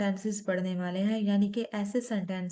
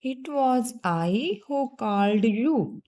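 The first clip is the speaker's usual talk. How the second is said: each word set apart, very slowly and a bit louder; slow, louder teacher's read-out of the answer; slow model sentence; 5 dB louder, much slower than usual. Shown as a demonstration. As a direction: spell it out slowly for the class, word by word. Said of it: "इसके लिए हम लिख लेंगे".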